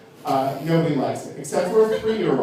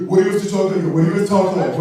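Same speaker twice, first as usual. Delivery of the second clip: mock straight guy; 3 semitones higher than usual. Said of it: "straight guys are always like".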